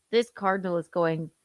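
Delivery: usual speaking voice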